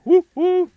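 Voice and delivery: loud, high mocking hoot; failed owl hoot. Shown as a demonstration. Streamer showing what owls don't sound like, hooting. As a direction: hoot it out as a bad example. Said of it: "It does not sound".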